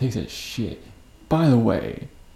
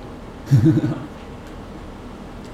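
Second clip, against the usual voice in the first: breathy chuckle, then trailing off; real laugh. Real, not acted. Podcaster laughing about not editing, breathy laugh. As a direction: laugh off your own bluntness.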